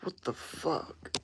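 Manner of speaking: slow, breathless whisper of disbelief. Real, not acted. Streamer reading a baffling chat message, whispered shock. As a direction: whisper it slowly, stunned.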